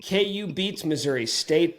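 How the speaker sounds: ordinary talk, nothing marked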